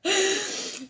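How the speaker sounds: short high giggle; high laugh. Real, not acted. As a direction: giggle at the joke. Laughs again after joke. (risadinha aguda)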